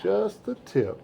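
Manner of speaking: mock-shocked echo of "Just the tip." in a high voice; incredulous echo. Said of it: "I said".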